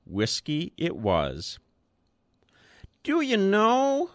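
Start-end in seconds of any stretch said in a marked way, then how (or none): 3.0-4.1 put-on high, thin old man's voice; an old man marvelling up at the boss; high piping wonder. A narrator's reading. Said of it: "peering up at the boss wonderingly".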